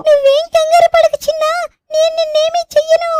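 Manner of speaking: put-on a friendly tiger calming a scared child; gentle tiger voice. Tiger reassuring frightened Shinchan it means no harm, gentle.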